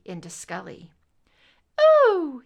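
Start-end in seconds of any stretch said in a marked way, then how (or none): 2.1-2.4 put-on high, awed little boy's ooh; child's ooh. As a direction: coo it like a small child. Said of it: "he said".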